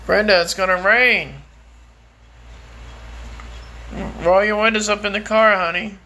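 loud call to wife. Real, not acted. Man warning his wife Brenda that rain is coming, loud call.

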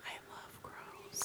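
soft breathy whisper. Real, not acted. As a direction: whisper it fondly. Sudden aside in whisper.

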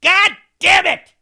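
high, loud yell of frustration; retold yell. Driver yelling alone at the radio, a loud frustrated shout. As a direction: shout it like you just caught the mistake. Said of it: "I yelled as I was driving".

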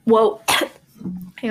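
coughing and choking mid-sentence. Real, not acted. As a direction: catch breath through a cough. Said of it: "I'm choking".

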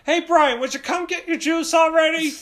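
high, loud calling voice; exasperated dad. Acted. Dad carrying juice calls his son, loud mock exasperation. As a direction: summon your kid as a hammed-up fed-up dad. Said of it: "And he's like".

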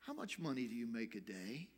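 low, soft, gentle question; hushed, gentle rabbi. Retold or imitated. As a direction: ask it quietly and tenderly, as the rabbi. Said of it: "very gently he said".

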